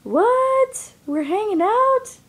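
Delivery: high, startled squeak of disbelief; shocked squeal. Retold or imitated. Retelling her shock when her ex asks about her plans. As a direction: blurt it out, caught off guard. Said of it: "And I was like".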